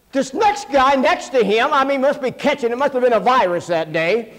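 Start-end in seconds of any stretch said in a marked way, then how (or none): none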